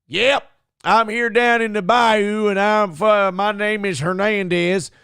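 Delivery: a rural Louisiana guy answering a pundit, slow Southern drawl; bayou farmer drawl. Retold or imitated. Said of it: "You got some guy going".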